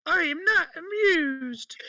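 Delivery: very high, slow, squeaky mock-royal voice with a crying wail; hammed-up squeaky queen. Retrying the Queen's line as a squeaky, wailing cartoon mouse. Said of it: "What are you, fucking Fievel Goes West?".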